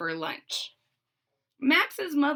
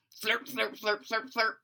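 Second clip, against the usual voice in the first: slurping sound; 6 dB quieter than usual. A narrator's reading. Sucking bottle.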